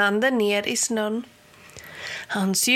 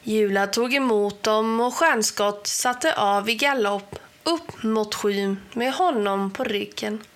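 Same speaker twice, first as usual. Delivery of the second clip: ordinary delivery, nothing marked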